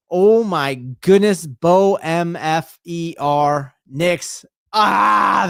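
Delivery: slow, emphatic fan celebration; real loud, drawn-out elation; exultant. Real, not acted. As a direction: stretch each word out, ending in a loud cry. Celebrating the quarterback's touchdown.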